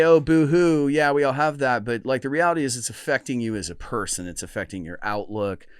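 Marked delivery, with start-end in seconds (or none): 0.0-1.8 mocking boo hoo, dismissive and loud; dismissive mockery. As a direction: brush it off like a scoffer. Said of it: "you could say".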